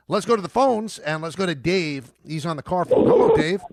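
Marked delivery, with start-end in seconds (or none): 3.1-3.6 high, loud radio hello to a caller; bright on-air greeting. Real, not acted. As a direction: sing out the caller's name.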